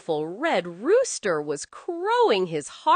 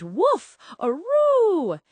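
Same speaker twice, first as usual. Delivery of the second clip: dog woof and aroo; dog bark and howl; 8 semitones higher, 5 dB louder, slower than usual. Acted. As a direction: bark then high howl. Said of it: "Dog went".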